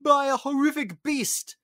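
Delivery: high, loud cry; acted panicked exclamation; alarmed Maurice. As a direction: blurt out the horror.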